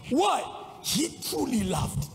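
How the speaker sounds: ordinary delivery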